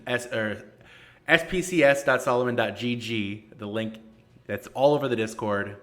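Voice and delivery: normal talking voice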